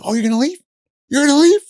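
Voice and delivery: building to a high, loud shriek; rising hysterical taunt; escalating taunt. Retold or imitated. Girlfriend about to snap at boyfriend, building to a loud high shriek. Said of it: "she's like".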